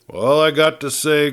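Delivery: a lightly growled sheriff's complaint; growled line. A narrator's reading. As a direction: growl it out like a gruff lawman. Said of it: "growled the sheriff".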